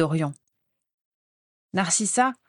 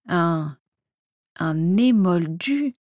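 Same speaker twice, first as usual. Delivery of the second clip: slow, halting stunned question; stammering in shock; much slower than usual. A narrator's reading. Narcissa hearing her sister loves a Muggle-born, stammering shocked. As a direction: stumble over the word in dismay. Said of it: "balbutia Narcissa, catastrophée".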